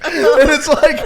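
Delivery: high, slow laughing words. Real, not acted. Telling friends a funny story, laughing.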